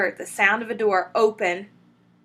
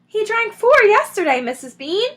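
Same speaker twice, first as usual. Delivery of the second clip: calling up; 13 semitones higher, 7 dB louder than usual. A narrator's reading. Mabel shouting up stairs.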